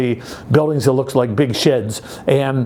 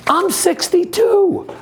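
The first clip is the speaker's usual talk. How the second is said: high, slow mock-shocked squeal; slower than usual. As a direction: play it up as a big surprise. Joking surprise at own age.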